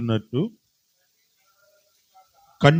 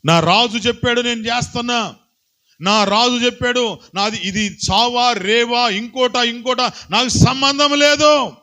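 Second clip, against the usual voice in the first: preaching at full force; 13 semitones higher, 6 dB louder than usual. Acted. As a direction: proclaim it like the loyal soldier. Voicing Uriah's loyal vow.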